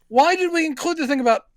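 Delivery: baffled, mocking the script; incredulous viewer. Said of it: "And you're like".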